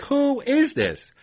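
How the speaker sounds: very high, drawn-out mock-angry question; exasperated demand. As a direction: imitate him stretching out each word. Road rager demands again.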